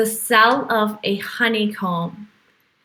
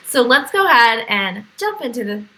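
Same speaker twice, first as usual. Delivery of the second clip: ordinary delivery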